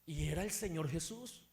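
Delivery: ordinary delivery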